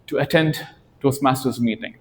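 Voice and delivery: ordinary talk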